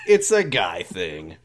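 mock macho. Mock macho repeat of the phrase.

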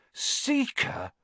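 high astonished squeak; astonished question. Acted. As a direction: gasp out the word. Ron astonished.

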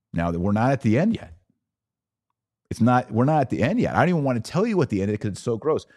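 normal talking voice

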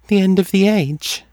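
high, detached oracle voice; distant. A narrator's reading. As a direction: pitch it high and far away, as if dreaming.

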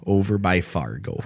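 a hint of the Fargo drawl, echoing his line; North Dakota accent. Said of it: "very slow and deliberate in their words".

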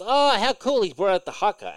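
high, loud, delighted imitation of his wife; excited wife. Husband imitates wife cheering the haka, high and delighted. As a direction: gush about it like she's thrilled. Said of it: "Michelle goes".

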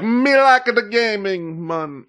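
high, drawn-out mock Jamaican accent. Streamer imitating how a friend's typed chat sounds, mock Jamaican accent. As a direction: put on an exaggerated Jamaican lilt, stretched out high. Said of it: "makes you want to do that accent".

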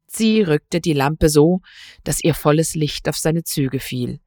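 ordinary delivery

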